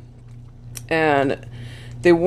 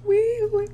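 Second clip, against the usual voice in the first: siren imitation; 14 semitones higher, 4 dB quieter than usual. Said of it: "singing on the way home".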